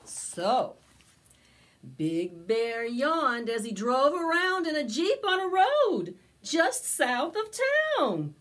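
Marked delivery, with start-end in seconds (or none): 2.0-8.3 storybook narration. A narrator's reading. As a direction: tell it to a circle of little kids. Storytelling continues.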